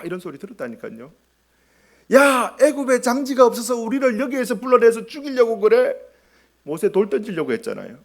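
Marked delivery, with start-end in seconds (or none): 2.1-5.9 high, raised-voice mock complaint; acted angry protest. Israelites turning on Moses in the wilderness, indignant protest. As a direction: hurl an indignant accusation as the crowd.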